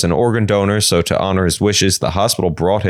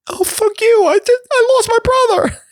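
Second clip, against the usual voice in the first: much higher, outraged voice; high indignant sister. Acted. Sister snapping at the doctor over her lost brother, high and outraged. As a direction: spit it out, offended.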